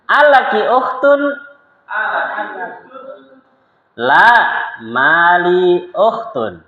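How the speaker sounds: slow, high, careful Arabic dialogue reading; slow lesson reading. Shown as a demonstration. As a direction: read each line slowly for students to repeat. Dialogue drill.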